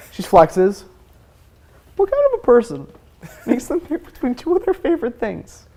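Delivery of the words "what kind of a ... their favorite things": higher, pained plea; emotional outburst. Acted. V cries out at being made to choose. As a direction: let her voice crack with upset. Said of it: "She gets really emotional".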